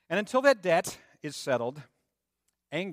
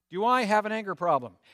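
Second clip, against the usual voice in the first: mock asking question; 5 semitones higher, 5 dB louder than usual. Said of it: "Ask your spouse".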